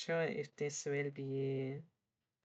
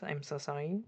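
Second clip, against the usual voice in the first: ordinary delivery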